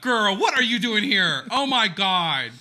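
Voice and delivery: a hint of a startled Jaime greeting; playfully voicing a surprised Jaime; mock Jaime. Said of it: "Jaime comes out".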